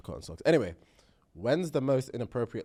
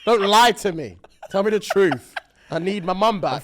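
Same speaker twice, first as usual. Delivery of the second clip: louder, higher demand; loud pleading; 6 semitones higher, 9 dB louder than usual. Acted. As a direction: raise your voice and plead. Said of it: "repeated louder".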